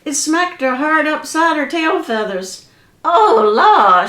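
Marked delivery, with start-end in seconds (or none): 3.0-4.1 hollering. Acted. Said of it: "she hollered".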